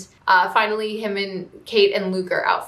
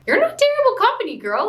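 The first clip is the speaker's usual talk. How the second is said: much higher, louder and fast, insisting; emphatic reassurance; 13 semitones higher, 3 dB louder than usual. Retold or imitated. Viewer shouting encouragement at the heroine, high and fast. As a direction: blurt it out at the screen, rushing to reassure. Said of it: "I had the same reaction ... I was like".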